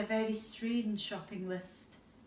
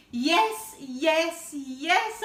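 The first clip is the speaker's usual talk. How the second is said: eager yes yes yes; 5 semitones higher, 10 dB louder, much slower than usual. Acted. The child giving in to Gideon, loud and eager. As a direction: blurt out the yeses.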